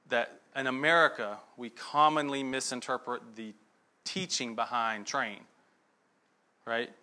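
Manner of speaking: ordinary talk, nothing marked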